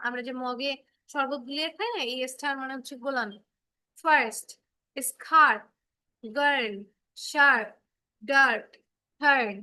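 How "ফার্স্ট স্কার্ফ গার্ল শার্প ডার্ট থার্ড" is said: ফার্স্ট, স্কার্ফ, গার্ল said one at a time, louder, with an "ar" vowel and no "ai"; r-controlled demo words. Shown as a demonstration. Teacher drilling words for students, loud and clear. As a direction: spell out ফার্স্ট, শার্প, থার্ড slowly with an "ar" vowel. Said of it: "দেখো আই এর পরে যখন আর বসেছে".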